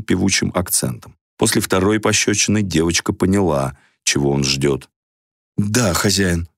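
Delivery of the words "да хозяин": disgusted. A narrator's reading. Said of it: "не скрывая в своём голосе отвращения".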